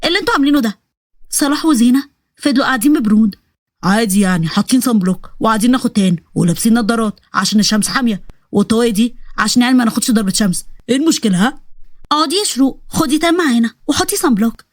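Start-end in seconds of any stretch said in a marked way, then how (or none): 3.8-11.6 low-voiced jittery soldier, puzzled and scared; lower, spooked young soldier talking to himself; low voice of a frightened recruit; nervous soldier. Salah voice again.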